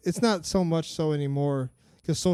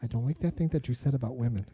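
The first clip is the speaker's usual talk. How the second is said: lightly voiced mom, disapproving; quiet, measured scolding from a mom; a hint of an offended mother; 4 semitones lower, 3 dB quieter, faster than usual. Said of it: "she's like".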